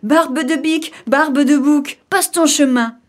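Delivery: high, loud taunting chant; kids' cry. Acted. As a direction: shout the rhyme as little goats. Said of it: "se mirent à crier".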